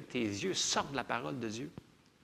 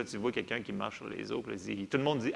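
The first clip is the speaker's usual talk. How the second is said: usual speaking voice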